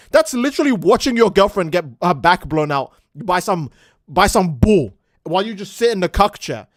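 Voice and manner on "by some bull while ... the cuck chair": ordinary talk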